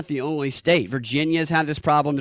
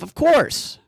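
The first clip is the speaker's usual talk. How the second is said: sarcastic; 9 semitones higher, 4 dB louder than usual. Real, not acted. Mocking Russia blame claims.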